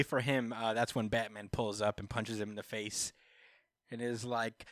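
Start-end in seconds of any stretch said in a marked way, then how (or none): none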